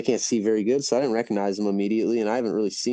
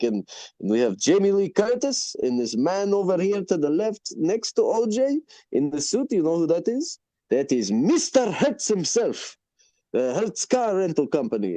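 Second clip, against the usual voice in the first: lightly voiced proud owner, higher pitch; restaurant owner; 6 semitones higher than usual. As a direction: show off famous guests one by one. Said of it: "he's like".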